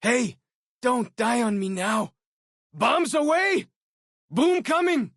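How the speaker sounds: high, loud warning yell; battle shout. Acted. Companion warning the player of a grenade, loud shout. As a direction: shout the warning over the blast. Companion throws explosives in combat.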